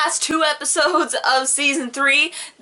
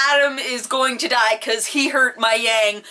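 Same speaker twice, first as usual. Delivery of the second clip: ordinary delivery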